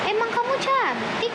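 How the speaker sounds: high mock teasing question; teasing brother. Retelling her brother teasing her about being pretty, high-pitched. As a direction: tease like a big brother. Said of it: "kata abang gitu".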